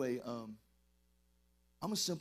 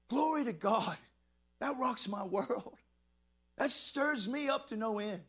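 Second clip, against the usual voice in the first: fervent preacher, slow and louder; preaching at full force; 8 semitones higher, 4 dB louder, slower than usual. Acted. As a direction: lift it up in praise, drawn out. Preacher fervour builds.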